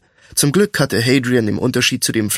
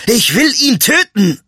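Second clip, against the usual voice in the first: high, furious outburst; angry scolding; 10 semitones higher, 4 dB louder than usual. A narrator's reading. Thief raging at the friend holding him back, angry high voice. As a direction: rage at being held back. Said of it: "schimpfte Royce".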